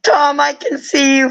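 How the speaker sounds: usual speaking voice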